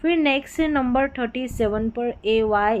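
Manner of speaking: usual speaking voice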